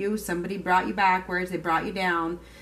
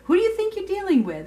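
much higher, loud, indignant; mock outrage, pitched way up; outraged disbelief; 13 semitones higher, 5 dB louder than usual. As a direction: play the offended one who won't be messed with. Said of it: "That's what I keep hearing".

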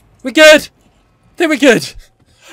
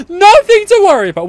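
higher, louder insistent cry; insistent reassurance; 10 semitones higher, 3 dB louder, faster than usual. Real, not acted. Player insisting all is fine mid-fight, loud reassurance. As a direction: push the reassurance out high and strong.

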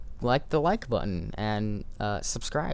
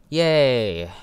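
excited cheer; 3 semitones higher, 9 dB louder than usual. Real, not acted. Cheering at ink guy.

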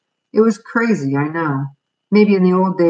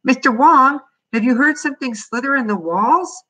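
high, raised voice chasing someone; eager high-pitched boy calling out; insistent calling; 8 semitones higher than usual. A narrator's reading. Boy pestering landlord who ignores him.

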